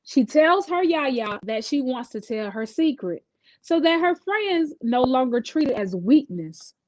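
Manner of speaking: plain reading voice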